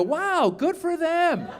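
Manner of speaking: high, drawn-out marvelling; detached admiration. Retold or imitated. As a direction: slowly marvel at someone else's luck. Detached reaction to booster news.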